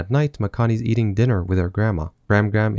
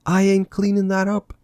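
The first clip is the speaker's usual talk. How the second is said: pitched-up, deadpan put-on voice; high character voice; 10 semitones higher than usual. Voicing a character's reaction.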